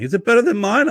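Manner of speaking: high, loud needling stepmom; stepmom again. Retold or imitated. Stepmom pressing again whether Jen's is better, high and loud. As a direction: push the question up high and loud. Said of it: "I'm like".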